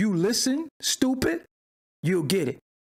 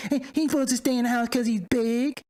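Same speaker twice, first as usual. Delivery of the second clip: higher, bass-free imitation of a commenter; mock them as a wimp, pitched up; wimpy mocking voice; 4 semitones higher than usual. Host imitates the commenter's excuse, wimpy mocking voice. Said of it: "got no bass in your voice".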